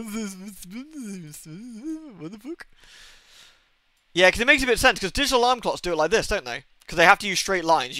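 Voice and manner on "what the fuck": hushed baffled. Retold or imitated. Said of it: "I'm just in there".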